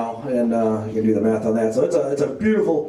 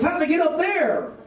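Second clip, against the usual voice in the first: mock puzzled lady; 12 semitones higher than usual. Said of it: "She says".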